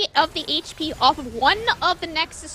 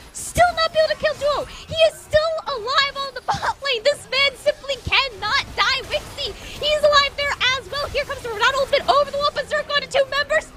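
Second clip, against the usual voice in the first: hyped-up play-by-play, higher and fast; excited; 7 semitones higher than usual. Real, not acted. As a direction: call it like you can't believe it. Teamfight hype, player won't die.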